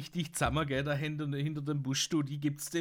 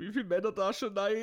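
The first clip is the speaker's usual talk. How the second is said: unter Lachen herausgerufen; lachend; 11 semitones higher, 3 dB louder, faster than usual. Retold or imitated. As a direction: vor Lachen kaum sprechend. Lacht und ruft Pointe. (Kumpel fragt lachend im Militärshop)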